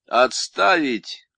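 loud, slow barked command; slow loud officer's yell; shouted order. A narrator's reading. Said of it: "крикнул замполит".

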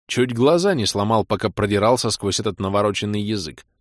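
ordinary delivery, nothing marked

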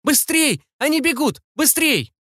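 high-pitched frantic urging; high, panicked warning cry; urgent scream. A narrator's reading. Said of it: "и закричала".

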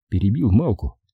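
plain reading voice

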